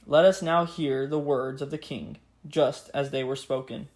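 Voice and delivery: normal talking voice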